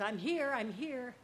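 joyful I'm here, answering God; eager answer. Acted. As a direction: call back eagerly to the voice. Pumpkin answers God calling him.

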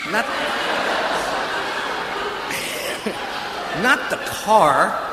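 slow and laughing through it; real laughter, words drawn out. Real, not acted. Laughing at himself.

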